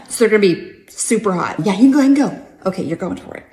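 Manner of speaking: hollered hangout chatter; loud excited greeting. Acted. Greeting [name] and offering him hot nuggets, loud and excited.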